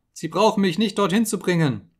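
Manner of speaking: high, loud panicked begging; desperate pleading scream. Acted. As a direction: plead for your life, voice cracking upward. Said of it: "schrie er".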